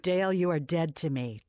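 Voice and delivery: usual speaking voice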